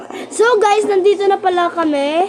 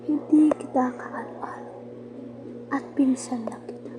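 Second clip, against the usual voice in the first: whispering; 3 semitones lower, 11 dB quieter than usual. Real, not acted. Teasing aside to cousin.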